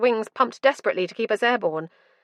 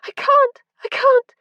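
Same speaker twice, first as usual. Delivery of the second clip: much higher and louder, breaking into a sob; sobbing cry; 8 dB louder than usual. A narrator's reading. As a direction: cry it out in desperate pain. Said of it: "I sobbed".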